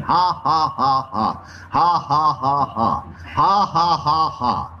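monotonous rounds of "ha ha"; put-on laugh in even, falling bursts; forced laugh. Shown as a demonstration. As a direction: laugh on and on without feeling it. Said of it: "just started laughing again".

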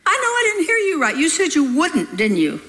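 as if shocked by the Lord; astounded reply. Retold or imitated. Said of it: "I replied".

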